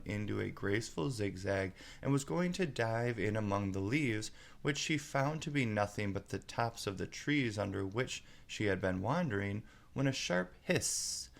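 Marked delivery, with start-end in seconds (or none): none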